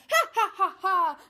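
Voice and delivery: loud, high wicked cackle; witch's cackle. A narrator's reading. Witch gloating at Gretel, cackling loudly. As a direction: cackle in triumph over the captured boy. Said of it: "cackling and laughing".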